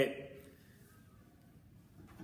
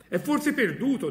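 much higher, louder taunting voice; mocking bystander; 12 semitones higher, 6 dB louder than usual. A scoffing bystander mocks the madman, high and loud. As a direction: jeer at the madman from the crowd. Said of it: "disse uno".

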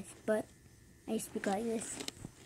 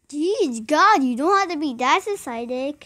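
usual speaking voice

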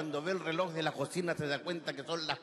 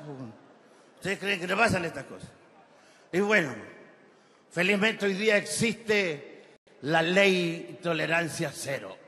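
usual speaking voice

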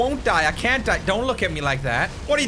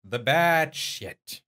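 ominous; 6 semitones lower, 4 dB quieter, much slower than usual. Acted. Ominous before eating wasabi.

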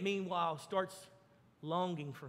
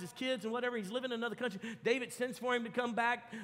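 ordinary delivery

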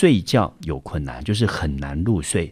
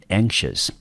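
"anxious" said ANK-shus, stress on the first syllable; 2 semitones higher than usual. Shown as a demonstration. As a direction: model "anxious" clearly, first syllable stressed, second falling away. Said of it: "重音就落在N这个音节".

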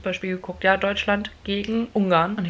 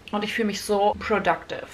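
ordinary delivery

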